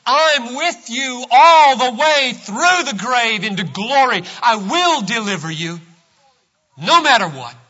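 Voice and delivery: loud, high, ringing sermon climax; preacher at full cry; full-force preaching. Acted. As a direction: thunder the promise over the congregation.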